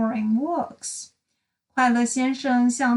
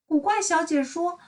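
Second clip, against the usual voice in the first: ordinary delivery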